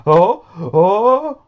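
high startled "oh, oh" as she lifts off; sudden rise, caught off guard; surprised gasp. Acted. Jody surprised as she floats up.

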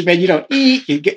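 ordinary talk, nothing marked

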